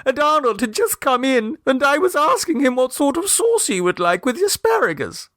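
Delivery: put-on high, fretful young mother's voice; anxious mother. A narrator's reading. Mrs Mummerby explains to Clovis, high and fretful. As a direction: keep the high worried mother going.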